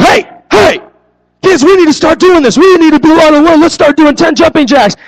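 high, fast, urgent coaxing; pleading commander. Retold or imitated. Pastor begging silent kids to start jumping jacks, high and urgent. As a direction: try to rouse kids who won't move. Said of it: "I'm like".

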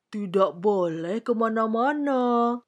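put-on voice with rounded vowels; warning king. A narrator's reading. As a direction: echo the order as a reminding king. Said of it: "kata Raja mengingatkan".

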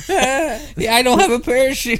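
high laughing squeal; squeaky giggling protest. Pretend. Laughing reply in character.

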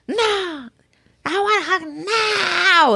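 put-on high, slow whiny toddler cry; demanding child. Mom imitating her daughter's high whiny demand. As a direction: wail it like a stubborn little girl. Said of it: "she's, like, demanding".

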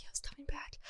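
whispered, sheepish confession. Real, not acted. Traveler confessing a late night out, whispered. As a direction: whisper it like a guilty secret.

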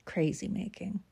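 a bitter whispered aside. Real, not acted. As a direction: breathe it out under your breath. Aside after retelling the fight.

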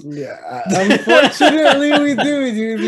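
laughing it out; breaking into giggles while answering. Real, not acted.

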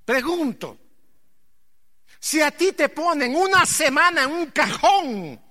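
high, loud fervent preaching; full-force preaching. Acted. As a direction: preach it out to the congregation. (predicación a todo pulmón)

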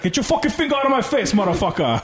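high mock outburst at a finger in his face; retold angry yell. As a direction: voice the guard snapping back, high-pitched. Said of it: "the Hawaiian guy's like".

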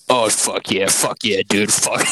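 loud, hyped-up reaction; excited. Retold or imitated. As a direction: shout it like a thrilled gamer. Retold friend's excited reaction.

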